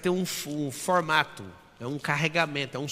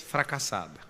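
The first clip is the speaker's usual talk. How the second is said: ordinary talk, nothing marked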